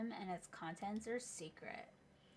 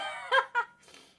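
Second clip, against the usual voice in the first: loud high laugh; 15 dB louder than usual. Real, not acted.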